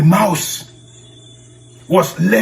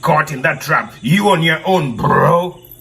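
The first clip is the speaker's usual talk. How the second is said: dismissive goat, slightly low, bleating between phrases; comic goat voice; 3 semitones lower than usual. Acted. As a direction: brush them off as a goat, bleating each "nah". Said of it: "said the goat".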